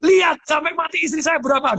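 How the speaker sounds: high, steady and certain retelling; firm vow. Retold or imitated. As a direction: repeat father's confident promise. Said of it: "Mantep banget papa saya".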